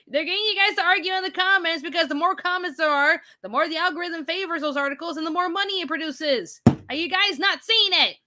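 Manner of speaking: high-pitched, incredulous explaining; exasperated pleading rant. Acted. Streamer urging viewers to see the bait, exasperated rant.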